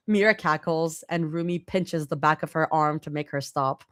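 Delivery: ordinary delivery, nothing marked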